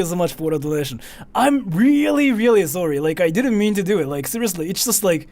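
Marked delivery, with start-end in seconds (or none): 1.3-4.1 emphatic apology. Real, not acted. Apologizing after startled scream.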